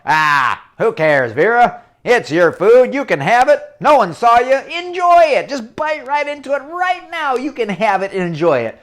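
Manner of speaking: loud, coaxing devilish voice; tempter voice. Acted. The bad thought urging Vera to eat the apple, loud and coaxing. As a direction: egg her on, loud and sly.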